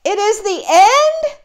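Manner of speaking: high, loud frightened question; alarmed. Acted. Gerald mishears Piggy and panics, high loud alarm. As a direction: gasp it out in horror.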